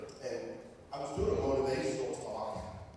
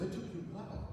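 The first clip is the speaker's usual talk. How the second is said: imitated remark; 8 semitones higher, 3 dB quieter, much faster than usual. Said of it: "someone goes".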